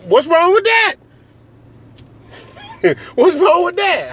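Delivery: high-pitched comic defensiveness; squeaked-up rhetorical question; mock protest. Comic feigned-innocent protest after outrageous claim.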